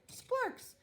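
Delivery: high delighted squeal of a find; excited squeal. Acted. Pluto to the crew, thrilled by her find, high squeal. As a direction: squeal it like a treasure.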